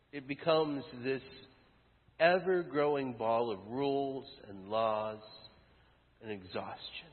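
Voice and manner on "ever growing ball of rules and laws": ordinary talk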